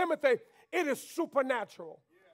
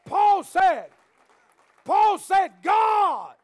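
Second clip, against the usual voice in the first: louder, higher, slow shouted preaching; full-force preaching; 5 semitones higher, 7 dB louder, slower than usual. Acted. Pastor rousing the church about God's view, shouted sermon. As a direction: drive it home to the congregation.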